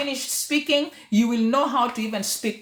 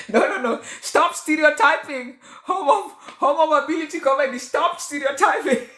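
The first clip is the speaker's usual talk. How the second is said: real laughter breaking into the words; laughing through words. Real, not acted. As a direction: try to get it out while laughing. Laughs while retelling a comment.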